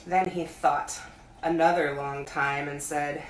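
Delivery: usual speaking voice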